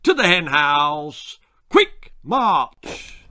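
drawn-out marching order, pitch jumping up; military turkey giving orders; drill sergeant. Acted. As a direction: call out a parade-ground command.

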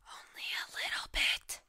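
loud stage whisper of "Only a little bit". Shown as a demonstration. Gamer joking about being obsessed, loud stage whisper. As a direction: whisper it like a sheepish confession. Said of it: "I'm partially obsessed".

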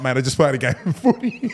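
laughing through the retold reply; half-laughing quote. Real, not acted. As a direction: chuckle out the excuse. Laughing retelling a pushy text.

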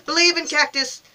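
loud, high cry of belief; rally your player with a shout; fervent cheering. Real, not acted. Cheers on the cactus.

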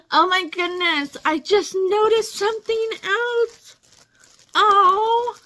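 gasp, then high, overwhelmed surprise; stunned and touched squeal; astonished discovery. Real, not acted. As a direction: exclaim it high, swelling to a loud oh. Discovers another gift during outro.

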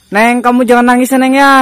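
high, loud call across a distance. Real, not acted. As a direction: call out to someone crying far away. Calls out to crying ghost.

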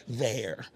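"there" low and soft with a careful eh vowel; carefully form a standard "there"; forced proper pronunciation. Shown as a demonstration. Local showing how much effort it takes, forced proper pronunciation. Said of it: "my mouth hurt".

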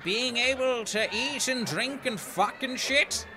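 put-on slow, high, scornful old ghost; mock the living like a cranky ghost; grumpy ghost boomer. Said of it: "ghosts would be the ultimate boomer".

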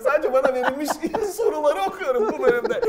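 giggling through the words; trying not to laugh while talking; stifled giggles. Real, not acted. Host giggling while reading questions.

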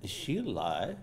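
usual speaking voice

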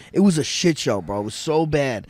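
plain reading voice

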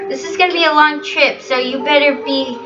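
normal talking voice

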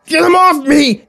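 high, drawn-out scream for help; pained yell. Acted. Philip overrun by crystals, yelling in pain. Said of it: "he yelled in pain".

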